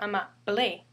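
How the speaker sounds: "amable" stressed on final BLE; wrong last-syllable stress. Shown as a demonstration. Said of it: "That's why we pronounce it as amable, not".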